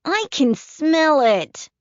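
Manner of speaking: put-on high, loud, slow boy's complaint; disgusted child. A narrator's reading. Flick complaining in the car, loud disgusted child voice. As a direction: drag it out, grossed out.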